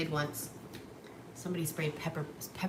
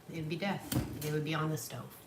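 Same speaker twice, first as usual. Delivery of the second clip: usual speaking voice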